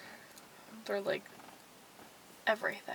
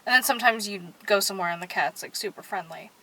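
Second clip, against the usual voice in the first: usual speaking voice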